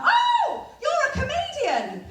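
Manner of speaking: very high, slow gushing squeal; excited recognition. Retold or imitated. Shop assistant realising who she is, gushing high squeal. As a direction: gush like a starstruck fan. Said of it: "She was like".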